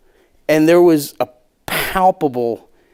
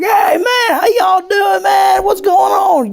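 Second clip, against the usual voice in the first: high, loud, hammed-up Southern yokel greeting; hacky Southern accent; 4 dB louder, slower than usual. Comedian on stage to Southern crowd, hammed-up Southern accent. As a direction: play the Southern good old boy to win the room. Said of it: "doing the hackiest Southern bullshit".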